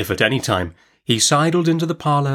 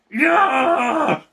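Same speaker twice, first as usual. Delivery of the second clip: high-pitched snarling cry; sudden shrill, snarled interjection; contemptuous snarl. A narrator's reading. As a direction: snarl it out in scorn. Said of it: "with such a snarl at him".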